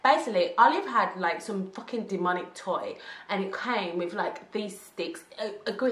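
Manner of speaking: normal talking voice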